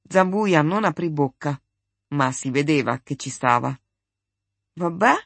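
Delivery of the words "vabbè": insistent question, rising at the end; insistente. A narrator's reading. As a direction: press for an answer with a rising tone. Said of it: "insistette Marcello". (domanda insistente, in salita)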